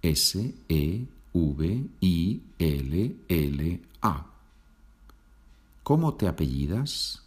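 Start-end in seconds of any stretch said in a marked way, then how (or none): 0.1-4.3 very slow, letter by letter; spelled out. Shown as a demonstration. Teacher answering how Sevilla is spelled, spelled out. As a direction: give each Spanish letter its own space. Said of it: "¿Cómo se deletrea Sevilla?".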